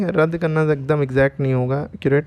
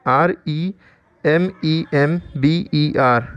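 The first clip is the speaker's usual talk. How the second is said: slow letter-by-letter spelling; much slower than usual. Shown as a demonstration. Teacher spells a new word for learners, slow spelling. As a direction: spell it slowly, each letter held apart.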